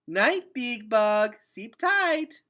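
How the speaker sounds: put-on very high, slow, loud little-girl voice; toddler goodnight. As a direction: sing it out sweetly like a tiny child. Toddler says goodnight to roaches.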